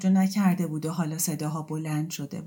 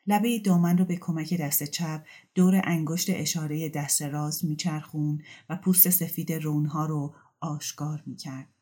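ordinary delivery

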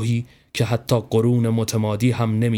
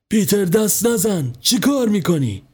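a higher, lightly raised scolding call; mild mock shout; 7 semitones higher than usual. A narrator's reading. Mother calling out to Peter not to touch, a mild raised call. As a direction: stop the boy, a touch raised. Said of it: "داد زد".

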